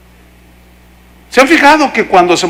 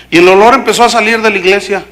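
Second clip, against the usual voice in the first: ordinary delivery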